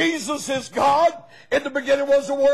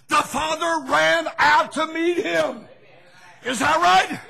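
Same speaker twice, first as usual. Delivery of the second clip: normal talking voice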